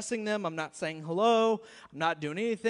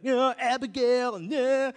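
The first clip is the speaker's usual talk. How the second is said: high, loud mocking singsong; mock the voice in your head, loud and high; 5 semitones higher, 10 dB louder than usual. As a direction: pastor mocking the message he must preach. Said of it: "I was like".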